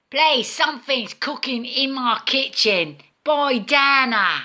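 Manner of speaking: attempted American accent for the voice assistant; put-on American accent. Scottish woman commanding voice assistant, put-on American accent. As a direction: fake an American accent, clearly enunciated. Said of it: "do it in an American accent".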